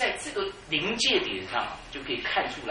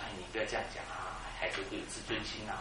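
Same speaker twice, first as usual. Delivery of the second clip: wife's gentle rebuke; 6 semitones lower, 11 dB quieter than usual. Retold or imitated. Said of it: "她说".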